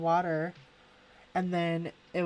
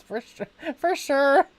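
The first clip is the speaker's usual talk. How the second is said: hammed-up "for sure, for sure", higher and louder; tossing off the old valley catchphrase; mock valley girl, higher and louder; 8 semitones higher, 9 dB louder than usual. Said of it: "Now you guys got me saying it".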